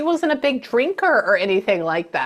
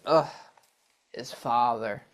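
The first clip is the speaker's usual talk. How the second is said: low, much softer scoffing dismissal; disdainful scoff; 10 semitones lower, 6 dB quieter than usual. Retold or imitated. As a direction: sneer at the mention of him. Mother scoffs at her husband.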